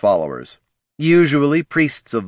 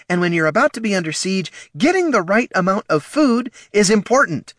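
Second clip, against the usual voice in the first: scolding; 12 semitones higher, 3 dB louder than usual. A narrator's reading. Gnoll scolding.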